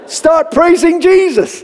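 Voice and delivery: high, slow, forceful order; mock command. Visiting father commands young man to praise, forceful. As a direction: order it with preacher's fervour.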